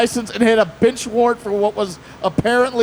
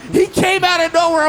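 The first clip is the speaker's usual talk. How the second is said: mock protesting driver; 8 semitones higher than usual. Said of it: "He's like".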